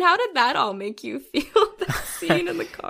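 teary laugh breaking the question; pushing the words out past the tears; laughing through tears. Real, not acted. Asking co-host about the car scene, teary laugh. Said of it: "I'm so sorry I'm crying".

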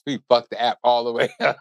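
real laugh breaking into the words. Real, not acted. Confessing the botched customs app to the co-host, laughing. Laughing at their own blunder.

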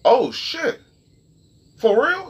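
high, startled oh shit; a light retold gasp of disbelief; mock surprise. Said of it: "And I was like".

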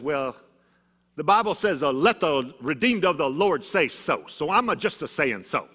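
grandma boldly quoting scripture, Oklahoma style; feisty Oklahoma grandma. Retold or imitated. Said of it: "That's the way she talked".

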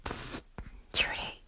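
psst, whispered conspiratorially; playful whisper. Acted. Caller sneaks a hello to Trudy, whispering. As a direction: lean in and whisper her name like a secret.

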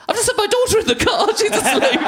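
high, loud, laughing retelling; laughing through it. Retold or imitated. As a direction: crack up while quoting yourself. Retelling his own panicked phone call.

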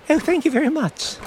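put-on chirpy old gentleman thanks; professor voice. Professor answers agent.

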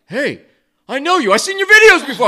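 high, loud, laughing shout of recognition; a stranger blurting out that he knows you, thrilled; excited recognition. Retold or imitated. Passerby recognizing the prankster, high excited laughing call. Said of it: "another guy in the middle of it's like".

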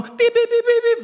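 rapid high-pitched dialing beeps; imitated phone beeps. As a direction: imitate keypad tones in a squeak.

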